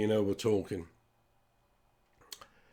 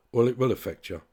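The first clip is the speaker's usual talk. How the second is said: usual speaking voice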